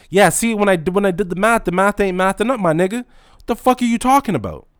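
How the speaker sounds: exasperated rant. Real, not acted. Store clerk ranting about a customer's logic, loud and exasperated. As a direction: blow up at the nonsense.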